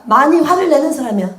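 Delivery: ordinary talk, nothing marked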